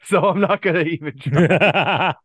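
said through a laugh; chuckling while talking. Real, not acted. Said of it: "told me that I sounded like a demon".

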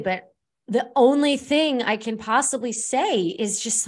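ordinary talk

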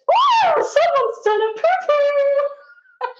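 put-on high, loud, drawn-out sing-song drunk girl; mocking drunk girl. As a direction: ham up a tipsy girl crooning in the toilets. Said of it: "she goes".

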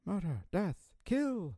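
high, soft, word-by-word chant; playful mock-machine readout; imitated computer alert. Mimicking the film's computer voice.

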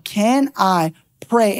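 slow, separated words ending on "pray"; "pray" stretched out for someone who misheard; emphatic correction. Retold or imitated. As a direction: lay out "can I pray" word by word. Corrects misheard word, spelling it out.

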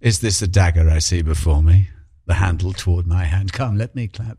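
low, declaiming Macbeth line; Shakespearean recital. Acted. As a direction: recite it like a stage actor. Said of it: "quoting Macbeth speech".

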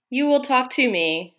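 ordinary talk

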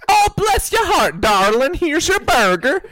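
high, gushing, doting burger lady; sweet burger lady. Retold or imitated. Burger lady serving a late-night customer, sweetly gushing. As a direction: fuss over the customer, drawn-out and high. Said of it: "where they're nice to me".

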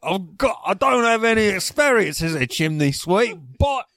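high mock cockney accent; British accent. American plays a cockney sweep with no experience, mock accent. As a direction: lightly ham up a cockney sweep. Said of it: "if you go to London".

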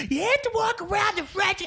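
shrill mock Karen scolding; Karen bartender. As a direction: snap it like an entitled Karen. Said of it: "this Karen bartender goes".

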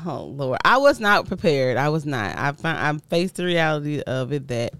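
normal talking voice

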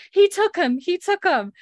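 high, loud retold cry of alarm; acted frantic outburst; mock panicked shouting. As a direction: imitate a hysterical mother raising the alarm. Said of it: "been drawn by the shouting".

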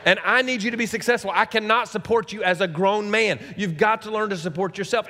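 high, insistent fatherly lecture; impassioned retold ultimatum; stern lecturing dad. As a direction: press the point hard on a teenage son. Acted lecture to his son.

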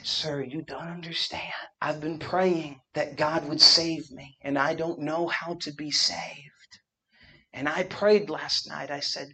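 soft, whispered retelling of a weeping plea; hushed plea. Retold or imitated. Hotel clerk begging the young preacher, softly whispered. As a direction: breathe her words out gently.

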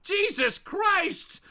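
high, appalled mock yell; shocked outcry. Bystander shocked at the neck snap, appalled outcry. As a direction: cry out in high-pitched alarm.

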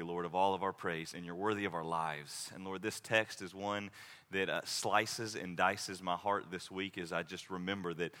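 soft pastoral prayer, fading to near silence. Acted. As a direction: pray it low and humble, fading toward the amen.

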